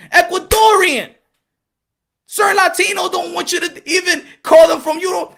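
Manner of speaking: rally the crowd, full voice; rousing shout. Real, not acted. Speaker firing up listeners about ethnic pride, shouting.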